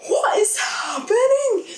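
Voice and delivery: high, loud, stunned "What is happening?"; shocked shout. Retold or imitated. At the Ken song in the cinema, stunned high shout. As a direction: gasp then cry it out in disbelief. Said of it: "But it was more like".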